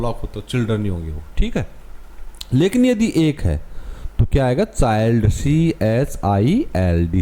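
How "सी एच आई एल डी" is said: letters spelled out very slowly; slow spelling. Shown as a demonstration. Teacher spelling a word for students, very slow. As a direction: dictate each letter, drawn out. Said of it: "कैसे लिखोगे इसको".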